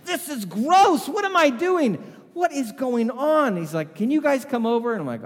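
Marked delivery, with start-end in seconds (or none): 0.1-3.6 very high, loud, slow revulsion; shouting in baffled disgust; disgusted disbelief. Retold or imitated. Paul disgusted by cigarette taste. 3.9-4.9 high, loud urgent ask; loud anxious request; urgent plea. Retold or imitated. Paul asks them over.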